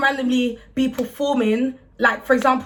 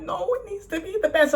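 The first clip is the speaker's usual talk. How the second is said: mock tearful protest, pitched higher; retold crying; 7 semitones higher, 3 dB quieter than usual. Performer retelling her upset to the interviewer, mock tearful. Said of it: "I just started crying".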